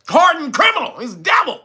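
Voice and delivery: hammed-up horror, pitched high; mock outrage. Vlogger mocking the charges with hammed-up outrage. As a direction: play the shocked prosecutor, hamming it up.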